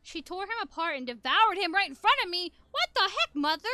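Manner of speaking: loud shocked cry; horrified outburst. Acted. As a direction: blurt it out in horror. Horrified memory of mother's attack.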